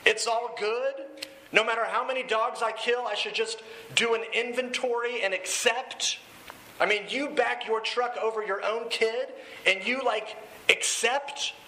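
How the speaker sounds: mock self-congratulation rising into scorn; Jesse. Dealer scoffing at rehab self-acceptance, sarcastic acted rant. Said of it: "And then Jesse goes".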